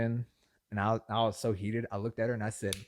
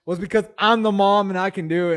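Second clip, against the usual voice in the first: high, loud parental pronouncement; bossy mom; 10 semitones higher, 13 dB louder than usual. Retold or imitated. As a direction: imitate a mom pulling rank. Mom's favourite comeback acted out.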